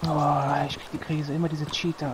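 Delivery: ordinary talk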